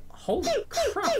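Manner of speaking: sudden high squeal, slow, breaking into a laugh; surprised laugh at own laggy game; laughing surprise. Real, not acted. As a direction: laugh at the lag in disbelief. Game suddenly lagging badly.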